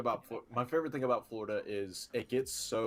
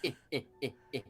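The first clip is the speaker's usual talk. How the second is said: chuckling Texan politician; mock Texas chuckle; George W. Bush; 3 semitones higher, much faster than usual. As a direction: do a stuttered presidential chuckle. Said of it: "That's more George W. Bush".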